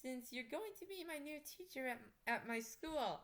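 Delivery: put-on high, bright-eyed girl voice, well above her own; a new student being politely creepy; Frankie's high voice.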